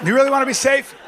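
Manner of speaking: high, loud challenge; loud question. Shown as a demonstration. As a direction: hit it hard the first time. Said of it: "repeated quieter".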